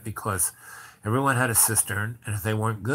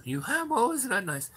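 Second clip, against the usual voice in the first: delighted cooing; 13 semitones higher than usual. Retold or imitated. Cooing over her art books.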